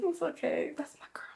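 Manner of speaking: whispered comfort. Real, not acted. Comforting a tearful friend.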